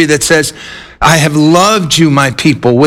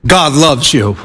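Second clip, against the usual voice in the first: lightly imitating a preacher's low, earnest "God loves you"; a hint of Billy Graham, dropped lower and tender; Billy Graham imitation; 4 semitones lower than usual. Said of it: "my best imitation of Billy Graham. It's terrible".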